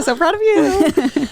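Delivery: high, drawn-out proud coo; playfully over-proud, high and slow; gushing mock praise. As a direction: coo it slowly like a proud parent. Playfully praising cohost for getting it.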